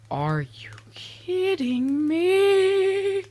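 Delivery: very high, drawn-out squeal of disbelief; exasperated squeal. Real, not acted. Builder whose redstone build misbehaves, exasperated high squeal. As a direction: stretch it out, thin and sky-high, in exasperation. Frustrated outburst at failed build.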